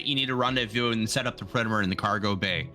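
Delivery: usual speaking voice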